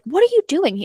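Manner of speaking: high, startled question; surprised Evie. Retold or imitated. Evie calling after the villain, high and startled. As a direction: pitch it up in alarm. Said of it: "and she's like".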